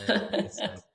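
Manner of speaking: high, loud laugh breaking through words. Real, not acted. Laughs while teasing about a Minnesotan accent.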